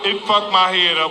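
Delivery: normal talking voice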